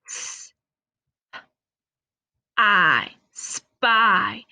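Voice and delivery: "S-" "I" "spy" split into slow separate sounds; sounding out. Shown as a demonstration. Teacher sounding out spy for young readers, slowly. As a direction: stretch each sound of "spy" apart, then blend. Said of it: "Let's sound it out".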